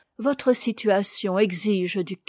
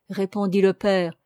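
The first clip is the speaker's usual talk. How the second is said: ordinary delivery, nothing marked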